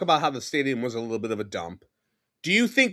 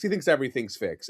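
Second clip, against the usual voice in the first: ordinary talk, nothing marked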